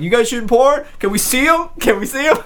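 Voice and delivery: high, loud, pleading imitation; mock a gaggle of excited gawkers; eager onlookers. Onlookers coming over to film crew, excited begging.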